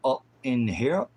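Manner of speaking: ordinary talk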